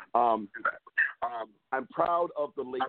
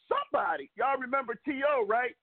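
ordinary delivery

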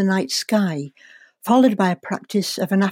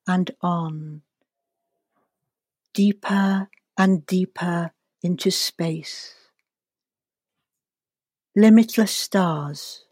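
guided meditation. Acted. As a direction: ease them gently into relaxation.

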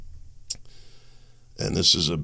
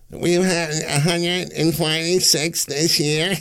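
higher, slow, sing-song mock of the vice president; mocking a politician; 10 semitones higher, slower than usual. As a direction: parody her grave speech, pitched up and drawn out. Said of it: "I wonder if she giggled when she said that".

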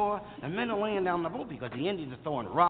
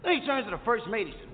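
ordinary delivery

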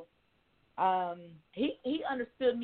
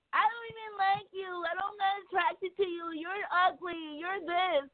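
high whiny mock of a guy; high mock whine; mocking him; 10 semitones higher than usual. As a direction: imitate his insults in a whiny voice. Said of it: "Then he called me".

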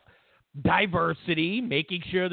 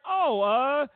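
mocking the presenters; slower than usual. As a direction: play the clueless presenters, then cheer. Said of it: "These two dummies just went".

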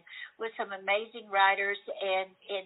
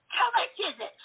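high, eager question; eager ask; 10 semitones higher than usual. Retold or imitated. Asking the driver the price, high eager voice. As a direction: ask it breathlessly keen.

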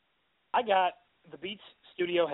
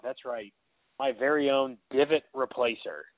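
ordinary talk

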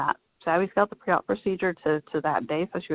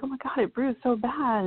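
freaked out; 7 semitones higher than usual. Retold or imitated. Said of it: "She was, like, freaked out".

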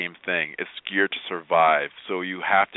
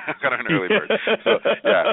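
laughing; 12 semitones higher, 4 dB louder, faster than usual. Real, not acted. Laughing about waking at three.

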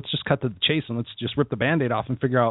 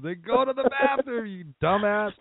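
high, slowed mock rebuke; mocking scold; 9 semitones higher, slower than usual. Host mocking a complainer, high and slow. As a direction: talk down to a fool.

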